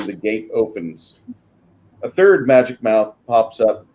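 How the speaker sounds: ordinary talk, nothing marked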